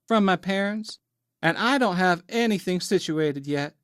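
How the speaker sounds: put-on high, innocent Southern accent; apologetic Southern belle. A narrator's reading. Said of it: "slightly affectionate Southern accent".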